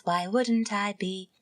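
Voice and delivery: usual speaking voice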